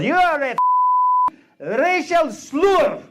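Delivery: put-on the Czech girlfriend yelling at a driver, accent thick; Czech accent in road rage. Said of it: "She goes".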